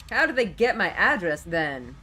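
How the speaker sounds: loud demand. Acted. Hostess character demands answer.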